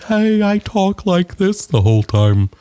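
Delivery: high, slow put-on voice; comedian's character voice. Asking who's the guy that does this, high slow character voice. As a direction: imitate a comic's odd stage voice, drawn out. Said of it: "who's the guy that does".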